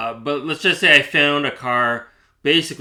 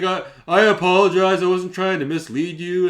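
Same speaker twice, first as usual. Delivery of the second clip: higher, sing-song fake apology; mock apology; 6 semitones higher, 3 dB louder than usual. Mimicking the seller backing down, higher. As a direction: imitate a sheepish apology. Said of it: "she's like".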